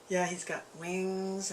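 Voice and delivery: ordinary talk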